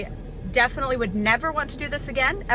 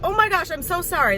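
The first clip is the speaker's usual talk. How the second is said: flustered, louder apology; panicked apology; 7 semitones higher, 5 dB louder than usual. Retold or imitated. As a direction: gush out a panicked sorry. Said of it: "I kinda panicked".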